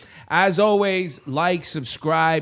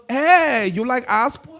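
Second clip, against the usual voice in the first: Mauricia; 10 semitones higher, 5 dB louder than usual. Retold or imitated.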